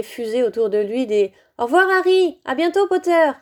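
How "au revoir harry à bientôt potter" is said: high, loud goodbye calls across a crowd; calling out. A narrator's reading. Pupils calling goodbye to Harry on the platform, loud and high. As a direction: call out to a friend leaving.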